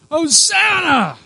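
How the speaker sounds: very high, loud, drawn-out shriek; desperate shriek. Acted. Preacher acts out crowds greeting Jesus, desperate shriek. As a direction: shriek it from the gut at full force. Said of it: "They were shrieking".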